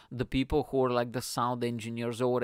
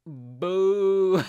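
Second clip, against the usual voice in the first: loud, drawn-out boo, pitched up; bored heckle; 7 semitones higher, 8 dB louder than usual. Retold or imitated. Bored audience member booing the comedian on stage, loud jeer. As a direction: heckle with one long boo. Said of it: "like, so bored".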